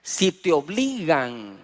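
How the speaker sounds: slow, weighted si te obligan; slow emphatic repetition. Shown as a demonstration. Pastor stressing the scripture for the congregation, slow. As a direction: lay each word down slowly. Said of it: "Por eso dice".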